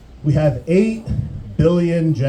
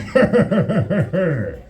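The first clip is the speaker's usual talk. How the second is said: pulsing, falling horror-villain cackle; 3 semitones higher than usual. Acted. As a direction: end the threat on a mock-evil cackle. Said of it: "Freddy Krueger par-, uh, popping out going".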